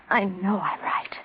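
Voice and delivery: slow insistent whisper. Acted. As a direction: whisper it as a plea. Intimate insistence before slap.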